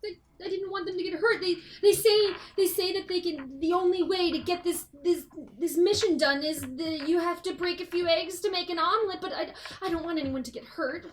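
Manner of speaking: high, fast, anxious rambling; nervous babbling. Acted. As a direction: blurt it out in a panic. NPC explains, fast and rattled.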